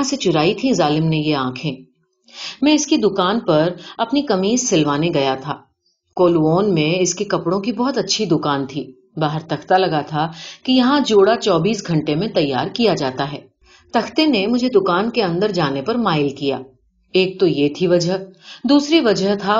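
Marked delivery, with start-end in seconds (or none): none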